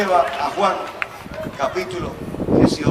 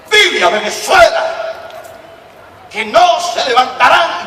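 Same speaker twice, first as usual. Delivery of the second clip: louder, higher, slow scolding repeat; scolding, strong; 6 semitones higher, 7 dB louder than usual. Retold or imitated. God repeats the message to the distracted preacher, scolding loudly. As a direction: repeat it like a stern rebuke. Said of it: "el Señor me habló fuerte".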